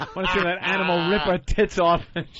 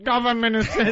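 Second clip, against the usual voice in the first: higher mimicked blurt; mock blurting out; 6 semitones higher than usual. Comic mocking a punchline, mock blurting out. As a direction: blurt it out in mockery. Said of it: "you can't just blurt out".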